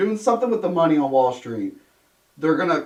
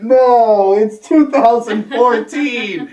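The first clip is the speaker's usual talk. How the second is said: louder, higher, slow retort; mock-exasperated reply to the villain, louder and slower; 6 semitones higher, 8 dB louder, much slower than usual. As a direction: spell it out slowly, fed up with the cliché. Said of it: "I'm like".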